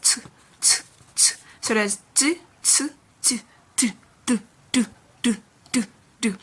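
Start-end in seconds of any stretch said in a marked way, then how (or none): 0.0-1.3 t as a short puffed "tu"; T sound demo. Shown as a demonstration. Teacher showing how the T letter is pronounced, T sound demo. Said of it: "it is pronounced". 2.1-6.3 d and t as short isolated syllables; similar-sound drill. Shown as a demonstration. Teacher drilling sounds that almost sound alike, similar-sound drill. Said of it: "they almost sound alike".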